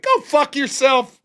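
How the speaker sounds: slow, loud, dragged-out curse; loud slow brush-off at work; retold yell. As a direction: spit it out slowly and angrily. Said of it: "I was yelling at him".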